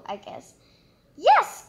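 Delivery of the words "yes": high, loud joyful yes; cried out. A narrator's reading. Witch shouting yes to the bird. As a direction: cry it out high and bright. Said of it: "cried the witch".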